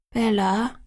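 a touch of sadness; understated sad questioning call. A narrator's reading. Alice turns to her friend for comfort, sadly. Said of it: "sagte sie traurig".

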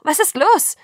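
put-on frightened girl calling out; alarmed call. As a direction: call after him in alarm. Bella running after Jacob.

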